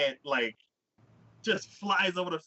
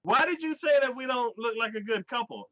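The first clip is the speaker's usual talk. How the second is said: higher-pitched retold annoyance; mock indignation; 9 semitones higher than usual. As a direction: play the offended girl confronting him. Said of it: "So she gets pissed off".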